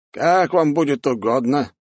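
high, slow deferential reply; compliant old mage. A narrator's reading. Mage accepts his lord's invitation, slow compliant voice. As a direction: agree slowly as an obliging old mage. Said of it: "не противился маг".

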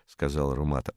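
ordinary delivery, nothing marked

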